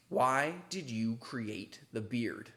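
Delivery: very slow, drawn-out question; slow dramatic. Acted. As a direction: read it like an ominous mystery. Reads question in mock-solemn way.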